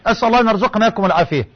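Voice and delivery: ordinary delivery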